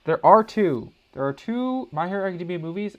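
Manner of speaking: blurting it out loud and high; sudden thrilled discovery; excited. Real, not acted. To the viewers, realizing there are two movies, excited.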